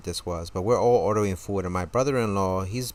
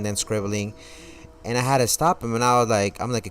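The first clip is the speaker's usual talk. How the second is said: ordinary talk, nothing marked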